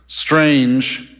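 mildly bored customer service voice; a touch of weary indifference. A narrator's reading. Representative answering Ebling's complaint, mildly bored. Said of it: "sounding bored".

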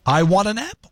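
ordinary delivery

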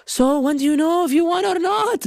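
anxious dad. Retold or imitated. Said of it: "My dad was, like, on edge".

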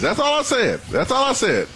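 high, pressing insistence; defensive emphatic repeat. Real, not acted. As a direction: drive the point home. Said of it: "repeats it".

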